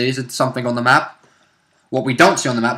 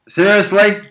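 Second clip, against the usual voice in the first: mock disbelief; 7 semitones higher, 5 dB louder than usual. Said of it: "Blitzel looked down and was like".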